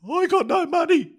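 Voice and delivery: very high, loud squealed plea; mock screaming. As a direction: imitate a man screaming in panic, voice shooting up. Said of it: "he started yelling and screaming and yelling".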